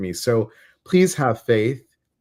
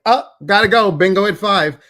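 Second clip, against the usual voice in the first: much higher and louder, breezy; breezy departing relative; 8 semitones higher, 8 dB louder than usual. Retold or imitated. Mocking the dead leaving for bingo, high and loud. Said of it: "It's not like".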